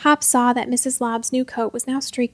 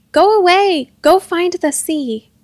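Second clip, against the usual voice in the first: high, loud outburst; fed-up, raised-voice command; exasperated shout; 7 semitones higher, 7 dB louder, slower than usual. A narrator's reading. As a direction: order them out forcefully. Fed-up girl ordering ghosts out.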